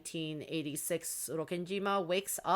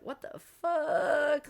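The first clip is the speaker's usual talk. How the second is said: high, drawn-out disbelief; mock bewilderment; 10 semitones higher, much slower than usual. Battler reacting to Maria's creepy laugh, mock bewilderment. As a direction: stretch it out, pitched way up.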